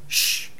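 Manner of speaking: soft, soothing shush; hushing shh. Retold or imitated. The Lord calming His people like children, soft shush. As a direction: hush as to an upset child. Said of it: "do that with your children".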